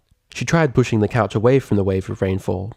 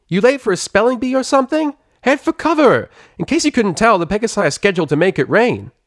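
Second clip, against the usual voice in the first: higher, cocky tomboy pegasus voice; Rainbow Dash, brash; 13 semitones higher, 4 dB louder than usual. Acted. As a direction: tease her like a sporty show-off.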